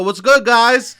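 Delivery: loud cheerful hello to the room; calling out greeting. Retold or imitated. Walking into the bodega, calling out a loud hello. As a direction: call it out as you walk in.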